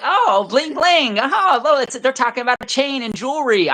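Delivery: high-pitched mock realization. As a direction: play a square person delighted to catch on.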